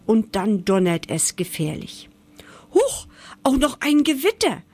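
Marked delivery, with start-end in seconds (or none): 2.7-4.6 hoch gequiekter, erschrockener Mausruf; quiekende Maus. Acted. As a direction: quiek es hoch und erschrocken wie eine kleine Maus. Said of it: "quiekt die Maus". (hoch quiekend, erschrockene Maus)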